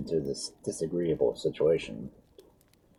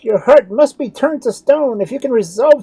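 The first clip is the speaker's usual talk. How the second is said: very high, loud desperate pleading; despairing plea; 10 dB louder than usual. A narrator's reading. Doctor begging Edric to speak, despairing. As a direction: plead against the silence. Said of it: "quite in despair".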